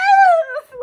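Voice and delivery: high loud squealing laugh; giddy shriek laugh. Retold or imitated.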